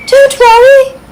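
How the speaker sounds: high, smiling fairy reassurance; comforting fairy. A narrator's reading. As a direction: smile through it to calm the squirrel. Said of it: "she said with a comforting smile".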